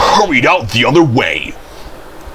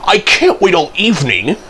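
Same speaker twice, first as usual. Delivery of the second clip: raised, impatient pitch; impatient interruption; 7 semitones higher than usual. A narrator's reading. Thomas can't wait for Toby, high and impatient. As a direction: protest the delay, pitched up. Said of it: "Thomas interrupted".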